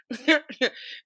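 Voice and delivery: high, falling chuckle; laugh. Real, not acted. Storyteller amused by the pastor exchange, high laughter. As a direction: laugh at your own story.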